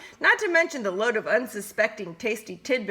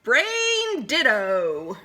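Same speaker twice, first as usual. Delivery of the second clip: very slow, louder gleeful agreement; drawn-out glee; 5 semitones higher, 5 dB louder, much slower than usual. Acted. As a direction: stretch each word with delight. Wolves agreeing on plan.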